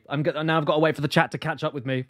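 usual speaking voice

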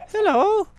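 very high sing-song hello; squeaky hello. Real, not acted. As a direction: greet the creature in a tiny high voice. Greeting a shaking mob.

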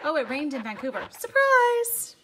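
high, loud singsong "surprise"; mock surprise. Crafter teasing about rain in Vancouver, mock surprise. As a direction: build to a hammed-up, drawn-out shout of surprise.

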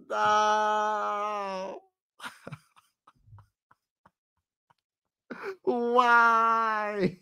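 high, crying no, then a pained why; real wail. Real, not acted. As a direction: let the disbelief crack into a cry. After the missed free throw.

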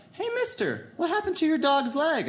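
put-on high, bright kid voice asking a question; curious little boy. Little boy asking the comic about his dog, high childlike voice. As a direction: play the small boy tugging at a stranger. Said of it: "He's like".

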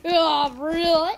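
loud, drawn-out mock amazement; mock surprise. Gamer mimicking a player's reply, loud mock surprise. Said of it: "and they're like".

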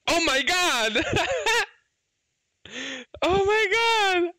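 high, excited cry of disbelief; stunned exclamation. Real, not acted. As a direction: cry out in real amazement. Gacha player reacting to a pull.

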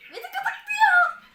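high, loud, slow and laughing; giggling squeal. Real, not acted. Girl giggling as her pet tickles her. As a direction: squeal the complaint through laughter.

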